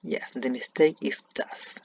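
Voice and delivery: ordinary talk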